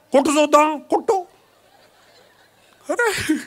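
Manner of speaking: daring 'hit me' through a laugh; laughing dare. Acted. Preacher acting a wife daring her husband, laughing. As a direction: laugh while taunting the husband. Acted couple's quarrel.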